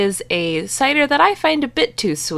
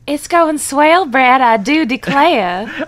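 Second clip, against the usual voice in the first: high, drawn-out Southern belle drawl; exaggerated Southern belle; 5 semitones higher, 4 dB louder, slower than usual. Co-host greeting Brad in an exaggerated Southern belle accent. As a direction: ham up a Scarlett O'Hara belle. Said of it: "Scarlett O'Hara Southern Belle, but exaggerated".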